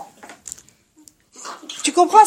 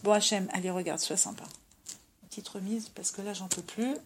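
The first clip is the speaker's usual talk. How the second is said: much softer, a worn-out quiet request to God; soft tired plea; 6 semitones lower, 14 dB quieter than usual. Retold or imitated. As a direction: plead gently, barely above a murmur. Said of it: "lui dire". (supplication douce et lasse, presque à voix basse)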